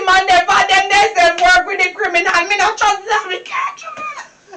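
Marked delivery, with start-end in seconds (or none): 3.3-4.6 very high, slow squeak of distress; squeaky cry. Acted. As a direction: squeal it in despair.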